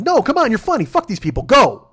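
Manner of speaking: high, loud coaching yell; pep-talk shout. Retold or imitated. Comic at the back of the room urging a struggling comic on, in a high, loud pep-talk yell. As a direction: fire her up from the back of the room. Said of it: "he's just like".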